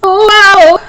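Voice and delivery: loud, high gasp of wonder; a big astonished gasp. A narrator's reading. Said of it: "gasped the fox".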